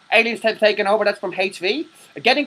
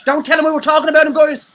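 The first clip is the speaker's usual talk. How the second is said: high quick mock panic; high, loud and fast; 9 semitones higher, 6 dB louder than usual. Joking to viewers that the brother is listening, high loud and fast. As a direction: blurt it out high, loud and quick like a caught kid.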